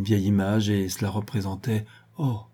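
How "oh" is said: usual speaking voice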